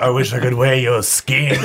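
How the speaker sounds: slow, eerie put-on creepy voice; creepy lingering delivery, then laugh; foul mouthed granny. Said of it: "It sounds better in a granny voice".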